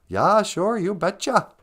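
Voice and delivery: a light Fargo-style "you betcha"; lightly voiced Minnesotan; Minnesota accent. Echoing Fargo's Norwegian farmers, light Minnesota accent. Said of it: "like the movie Fargo".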